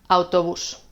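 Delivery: "autobús" stressed on the final bús; stress on last syllable. Shown as a demonstration. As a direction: put the weight on bús in "autobús". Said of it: "tienen acento en la última sílaba".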